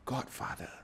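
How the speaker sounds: breathy whisper, head bowed; whispered submission. Retold or imitated. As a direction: surrender in a hush. Bonazera finally submits.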